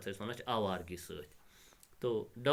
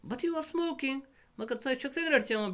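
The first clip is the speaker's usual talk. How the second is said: very high, astounded daughter; acted astonishment in a raised pitch; still astounded; 3 dB louder than usual. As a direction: exclaim it high in disbelief. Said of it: "still astounded".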